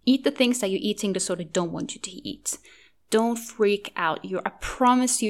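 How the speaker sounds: ordinary delivery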